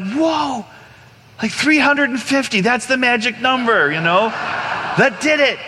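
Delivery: high, thrilled realization; amazed triumph. Retold or imitated. Man amazed his prayers seem to work, high triumphant awe. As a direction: marvel as if you found the winning formula.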